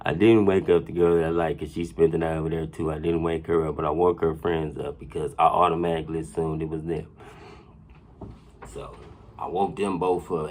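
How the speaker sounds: ordinary talk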